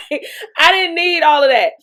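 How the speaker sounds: giggling disbelief; laughing. Real, not acted. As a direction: laugh while you say it. Laughing while telling it.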